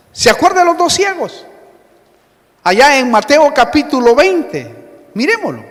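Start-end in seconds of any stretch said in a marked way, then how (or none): none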